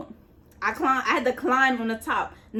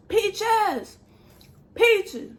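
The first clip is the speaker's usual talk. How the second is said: men calling a name, higher, from across the cells; 9 semitones higher than usual. Retold or imitated. Men in nearby cells calling to Peaches, mock holler.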